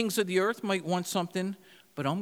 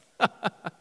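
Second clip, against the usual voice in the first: short burst of loud laughter; real laugh; 5 semitones higher, 5 dB louder than usual. Real, not acted.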